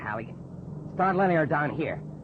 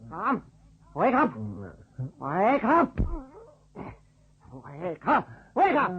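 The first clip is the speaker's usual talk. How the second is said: repeated urgent wake-up calls; calling out; 8 semitones higher, slower than usual. Acted. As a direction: shout to rouse him. Calling out to unconscious man.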